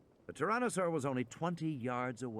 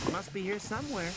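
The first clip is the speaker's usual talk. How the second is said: put-on high, quick boy's voice; 9 semitones higher, faster than usual. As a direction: rush the excuse like a kid. Tim answers quickly, high.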